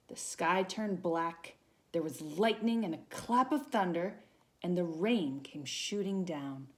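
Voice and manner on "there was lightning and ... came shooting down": ordinary delivery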